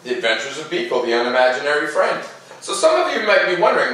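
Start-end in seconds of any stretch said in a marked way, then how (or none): 0.0-2.2 put-on gentle read-aloud to children; storybook narration. A narrator's reading. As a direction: tell it like a bedtime story. Reads picture book aloud.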